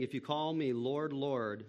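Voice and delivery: ordinary talk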